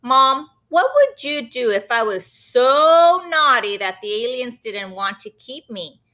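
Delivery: ordinary delivery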